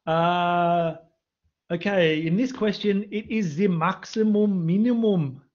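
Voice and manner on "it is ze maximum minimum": German accent. Shown as a demonstration. Maths teacher playfully naming the theorem to the class, German accent. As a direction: put on your best German accent. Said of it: "That's my best German accent".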